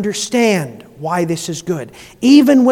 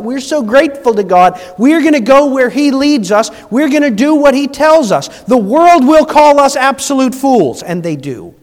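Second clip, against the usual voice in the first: louder and higher, hammering each line; preaching at full force; 4 semitones higher, 6 dB louder than usual. Acted. Preacher to congregation about the world's mockery, loud preaching. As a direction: build it like a pulpit climax.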